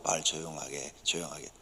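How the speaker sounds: very soft and low. Shown as a demonstration. Preacher demonstrating the right way to say the same words, quiet and low. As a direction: drop the pitch and say it quietly. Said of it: "톤 낮춰서".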